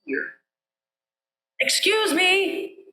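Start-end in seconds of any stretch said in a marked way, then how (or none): 1.6-2.9 slow, high, loud call. A narrator's reading. Mouse calls into the elephant's ear, loud and slow. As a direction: stretch it out into the ear. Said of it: "he called right into that ear".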